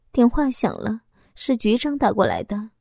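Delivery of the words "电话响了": ordinary delivery, nothing marked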